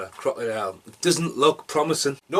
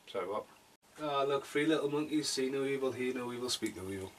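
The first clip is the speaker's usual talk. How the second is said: much softer, absent aside; feigned distraction; 11 dB quieter than usual. Pretend. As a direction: drift off quietly to something nearby. Acts out being distracted mid-review.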